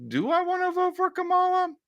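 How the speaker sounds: high falsetto mock question; mock wondering. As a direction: squeak out a put-on moment of wondering. Mock reaction to watching ad.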